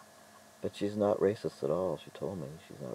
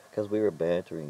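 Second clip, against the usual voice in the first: normal talking voice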